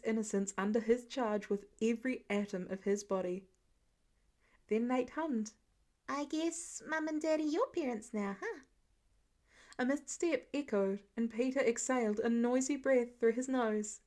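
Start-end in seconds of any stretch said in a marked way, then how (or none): 6.1-8.6 put-on musing little boy. Child musing to Peter.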